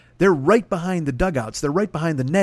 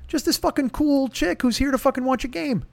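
ordinary talk

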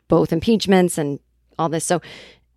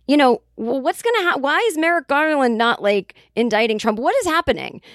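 higher-pitched mock frustration; retold frustrated questioning; 6 semitones higher than usual. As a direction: imitate exasperated voters, short of yelling. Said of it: "they were like, "We're frustrated".

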